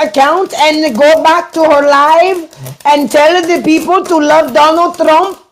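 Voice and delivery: high-pitched put-on voice mimicking a troll; mock troll voice. Mockingly voicing an online troll.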